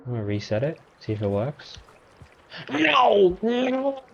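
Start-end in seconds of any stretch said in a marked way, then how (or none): 2.7-4.0 sharp high yelps; startled no. Real, not acted. Gamer yelping at the monster, startled high cry. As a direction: yelp it out as it grabs you. Gamer reacts to enemy attack.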